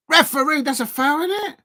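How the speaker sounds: high, incredulous appeal for a foul; appealing to the ref. Real, not acted. Fan on stream begging the referee for a foul, high indignant appeal. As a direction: demand the foul call, pitch climbing.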